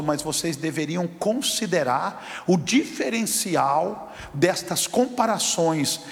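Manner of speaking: slow, building to a loud proclamation; voice of God. Acted. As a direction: proclaim as God, rising to a shout. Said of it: "Deus disse, falou". (a voz de Deus, crescendo até gritar)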